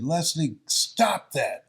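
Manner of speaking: retold mother's loud rebuke; sharp scolding. Mother scolding child in retold scene.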